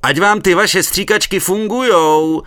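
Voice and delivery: loud high yell across a river; shouting across the water. A narrator's reading. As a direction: bellow it over the water. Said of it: "zakřičel přes vodu".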